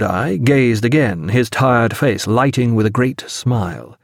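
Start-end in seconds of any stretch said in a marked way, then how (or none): none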